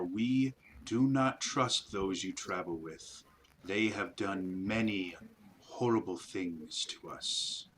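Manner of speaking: slow, hushed alien creature voice; eerie spider voice. Acted. GM voicing the spiders to the party, slow eerie creature. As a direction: speak like an ancient hive creature, slow and cold.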